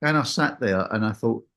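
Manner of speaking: normal talking voice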